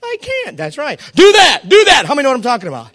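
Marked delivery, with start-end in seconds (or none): 0.0-0.6 lightly voiced wife, much higher and softer; a hint of a worn-out wife pleading. Voicing the weary wife. 1.2-2.0 loud, higher mock orders; demanding husband. Law barking orders.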